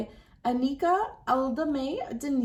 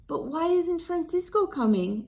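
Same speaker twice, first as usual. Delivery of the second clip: put-on child Rosita; 8 semitones higher than usual. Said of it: "asks Rosita".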